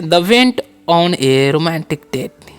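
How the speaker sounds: very slow, word by word English; slow dictation. Shown as a demonstration. Teacher to students, slow dictation. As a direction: spell it out slowly for the class.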